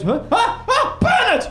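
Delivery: high, startled shriek of a dog's name; posh lady shrieking. Retold or imitated. Posh lady by the pond shrieking at her dog. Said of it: "she goes".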